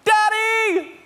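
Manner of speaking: put-on high-pitched excited child's yell; child calling out. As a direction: shout for your father like a thrilled kid. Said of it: "all I can hear is this".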